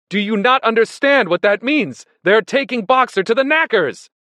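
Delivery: high, loud, alarmed outburst; desperate shouting. A narrator's reading. Benjamin telling animals Boxer's fate, shouting in alarm. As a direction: drive the awful truth home loudly.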